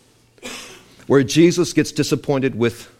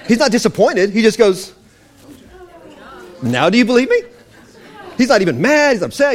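loud laughing preach; 8 semitones higher, 4 dB louder than usual. Real, not acted. Preacher laughing at his point.

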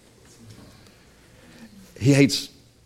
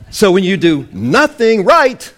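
rising preach; 5 semitones higher, 7 dB louder than usual. Real, not acted. Building sermon climax.

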